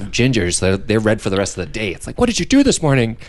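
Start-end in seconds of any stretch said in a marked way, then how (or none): 2.2-3.2 curious asker. Retold or imitated. Imitating people asking a ginger.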